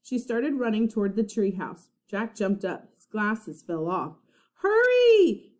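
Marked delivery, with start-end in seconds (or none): 4.6-5.5 high loud call, breaking; called back. A narrator's reading. Annie calling back to Jack on the beach, loud alarmed call. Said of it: "Annie called back to him".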